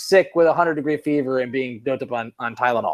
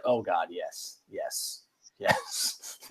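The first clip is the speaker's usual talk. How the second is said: soft, fading to a whisper; mock secret agreement, dropping to breath; hushed agreeing; 9 semitones lower, 13 dB quieter, much slower than usual. Friends murmuring agreement, softer and softer.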